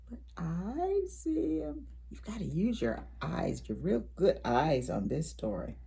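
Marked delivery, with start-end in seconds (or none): 0.1-1.9 breathy secret whisper, slowed; a conspiratorial whisper to little listeners. Acted. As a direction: let the kids in on a secret, hushed. Secret aside to the children.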